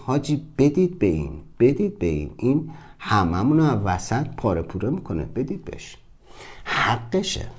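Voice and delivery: soft voice fading to a whisper; spooked, hushed urging. Acted. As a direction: urge the others under your breath, mock-scared. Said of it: "جهان هستی گرخید".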